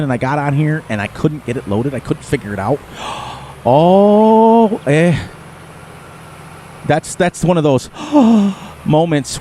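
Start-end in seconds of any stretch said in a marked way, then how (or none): 3.0-3.6 sudden breathless gasp of shock; startled intake of breath; sharp gasp. Retold or imitated. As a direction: suck in air, caught off guard. Shock at failed first stream.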